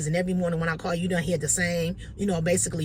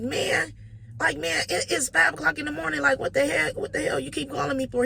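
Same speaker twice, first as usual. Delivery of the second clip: higher-pitched exasperated whine; a mild mock rant about the early call; annoyed groggy complaint; 8 semitones higher than usual. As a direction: gripe at the caller for waking you. Woken at five, irritated.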